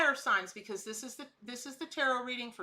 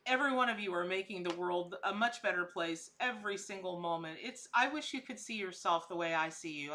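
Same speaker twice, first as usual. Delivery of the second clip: ordinary talk, nothing marked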